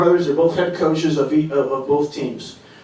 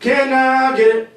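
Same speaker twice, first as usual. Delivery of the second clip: slow, higher, growly sung croon; 8 semitones higher, slower than usual. Acted. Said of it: "this is my impression of [name]".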